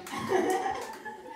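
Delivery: sudden high laugh; high burst of laughter. Real, not acted. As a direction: laugh out loud at own joke. Laugh after punchline.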